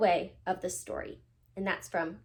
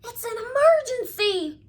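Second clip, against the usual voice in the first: high, loud panicked cry, almost laughing; frantic cry; 12 semitones higher, 6 dB louder than usual. A narrator's reading. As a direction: yelp it out like a frantic little owl. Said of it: "cried Ozzie".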